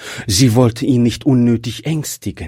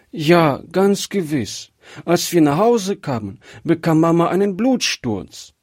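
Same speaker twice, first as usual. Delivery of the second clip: sobbing; 7 semitones higher than usual. A narrator's reading. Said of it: "bitterlich schluchzende Kind".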